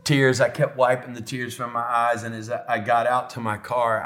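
ordinary talk, nothing marked

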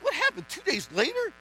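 high startled squeak of confusion; mock bewildered. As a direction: act baffled at yourself. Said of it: "I'm like".

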